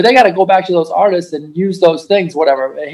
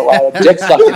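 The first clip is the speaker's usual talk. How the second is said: laughing. Real, not acted. Joking remark, breaking into laughter.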